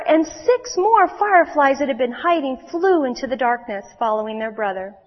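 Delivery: put-on lively storyteller voice for young listeners; children's story reading. A narrator's reading. Preacher reading a story to the children. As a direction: read it like a bedtime story to kids.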